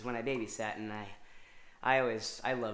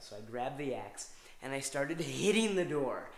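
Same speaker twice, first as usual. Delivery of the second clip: ordinary delivery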